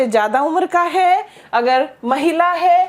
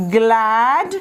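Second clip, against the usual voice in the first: slow model phrase; 2 semitones lower, much slower than usual. Shown as a demonstration. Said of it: "ये भी कह सकते हैं".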